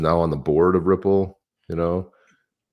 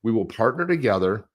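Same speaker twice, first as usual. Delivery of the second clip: higher, measured read-aloud of a political statement; mock statement reading; 4 semitones higher than usual. Host reading the candidate's crypto comments, mock-formal read-aloud. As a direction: read it out deliberately, pitch lifted.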